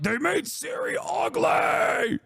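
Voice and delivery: high, shouted whiny mock tantrum; outraged fanboy. Streamer mocking gamers angry about Ciri's looks, hammed-up outraged shout. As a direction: wail it in mock outrage, drawing out the words. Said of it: "these guys are like".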